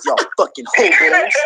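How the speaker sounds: high, loud laugh through the insult; bitter laughing yell. Real, not acted. Ranting fan laughing insults at rivals. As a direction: laugh it out angrily.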